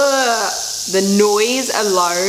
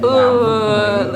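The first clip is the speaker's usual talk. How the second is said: usual speaking voice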